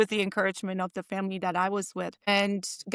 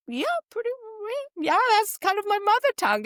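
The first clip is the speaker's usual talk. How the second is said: much higher, breezy casual reply; retelling her own answer; 12 semitones higher than usual. Retold or imitated. Telling the boss about her Spanish, retold in a high breezy voice. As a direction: toss it off lightly, pitched up. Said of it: "I said".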